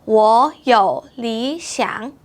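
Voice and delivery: 我有理想 very slow with sandhi on 我 and 理; slow, each tone marked; slow tone demo. Shown as a demonstration.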